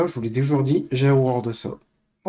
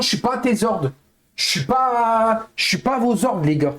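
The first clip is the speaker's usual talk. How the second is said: raised, heated scolding of chat; fed-up anger; 7 semitones higher than usual. Real, not acted. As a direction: tell them off, at the end of your rope.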